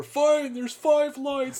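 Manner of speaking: a prisoner cracking under torture; tortured prisoner. Retold or imitated. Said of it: "like that scene from nineteen eighty-four".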